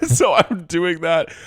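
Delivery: high, slow, laughing retelling. Real, not acted. Laughing to her cohost about sobbing into potatoes. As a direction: squeal it out through laughter.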